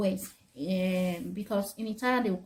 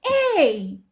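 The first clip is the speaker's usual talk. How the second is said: sudden loud yell of Hey; retold scream; 5 semitones higher, 7 dB louder than usual. Mother recreating her scream at her son, a loud yell. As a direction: bark it at the child like a fed-up mother. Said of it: "I screamed and I shouted at him".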